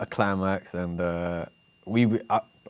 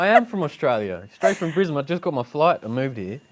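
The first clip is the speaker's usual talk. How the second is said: put-on higher-pitched, joking Australian accent; 7 semitones higher than usual. Guest claims to come straight from Brisbane, in Australian accent. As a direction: pass yourself off as Australian. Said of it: "do it in an Australian accent".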